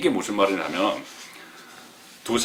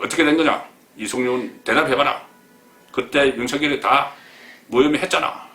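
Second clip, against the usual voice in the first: higher, louder bullying demand; mock browbeating; 5 semitones higher, 4 dB louder than usual. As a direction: bark at the prosecutor in mock fury. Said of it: "이렇게 막 윽박을 지는". (윽박지르듯 높고 큰 목소리로)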